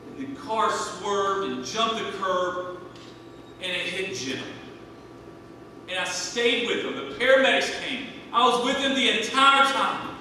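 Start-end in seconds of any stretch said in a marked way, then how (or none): none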